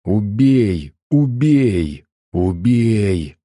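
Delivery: sinister drawn-out chant of a crone; hissing old woman. A narrator's reading. Old woman urges the mother to kill, hissing. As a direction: stretch each word like an eerie command. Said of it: "шипит старуха".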